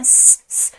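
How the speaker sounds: drawn hissing "sss" to silence someone; imitated shushing. Everyone shushing the child in the emperor's tale, imitated hiss. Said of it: "همه بهش گفتن".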